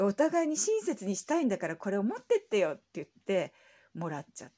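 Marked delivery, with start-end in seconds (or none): 0.0-2.7 mock insistence, louder and higher; pressing the gift on her, half laughing; retold friendly insistence; insistent plea. Shop girl insisting, retold.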